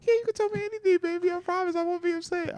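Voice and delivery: high falsetto girlfriend impression; mock girlfriend. Girlfriend promising not to be upset, mock falsetto. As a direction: reassure him in a put-on, very high woman's voice. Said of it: "She's like".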